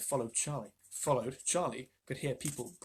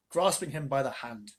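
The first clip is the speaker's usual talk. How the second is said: ordinary delivery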